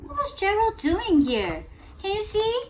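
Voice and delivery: plain reading voice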